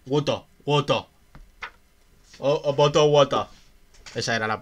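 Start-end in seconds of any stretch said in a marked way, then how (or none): 0.1-1.0 louder, hammed-up British accent on Water, water; mock British accent. Mocking how the British say water, in a British accent. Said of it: "la palabra esta que se ríe todo el mundo de los británicos". 2.4-3.5 loud, exaggerated British accent, bottle water with dropped t's; mock British accent. Teasing the British way of saying bottle water, in a British accent. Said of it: "que se ríe todo el mundo de los británicos".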